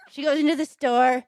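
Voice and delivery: normal talking voice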